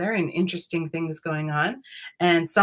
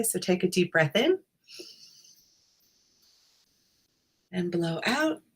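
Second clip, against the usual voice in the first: guided meditation. Acted. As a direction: ease listeners in, unhurried.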